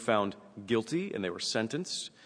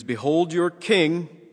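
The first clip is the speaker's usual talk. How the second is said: slow, high, loud announcement; grand proclamation; 7 semitones higher, 8 dB louder, much slower than usual. A narrator's reading. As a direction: present him to the crowd, drawn out. Acted quote, Pilate presents the king.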